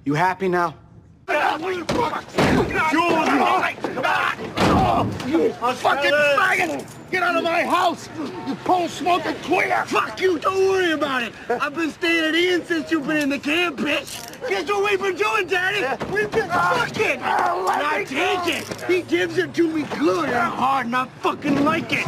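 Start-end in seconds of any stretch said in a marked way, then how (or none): 1.3-9.8 high-pitched enraged yelling; furious shouting. Acted. Acted violent quarrel, throwing someone out. 9.9-22.1 very high, enraged shouting; defiant screaming. Acted. Acted screaming quarrel, defiant confession.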